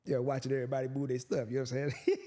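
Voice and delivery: ordinary delivery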